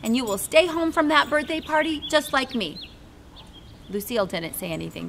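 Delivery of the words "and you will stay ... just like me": high, eager and bubbling over; excited. Acted. Junie B. bursting with her twin idea to Lucille, excited. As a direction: burst out with a brand-new idea. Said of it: "my whole face got happy".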